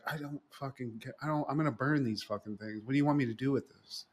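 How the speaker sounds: soft, fast muttered inner rant; inner grumble. Retold or imitated. David's inner thoughts at the mom, soft muttered rant. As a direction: grumble it under your breath. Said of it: "inside is like".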